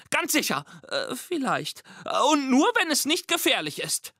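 squeaky monster half-bragging, half-hedging. Acted. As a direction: squeak along, unsure of yourself. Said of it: "Monster mit Piepsstimme".

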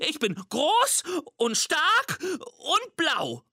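high, slow squeaky little monster; squeaky monster boast. A narrator's reading. Little monster claims to be Walter, slow squeaky boast. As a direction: puff up a tiny voice to sound big.

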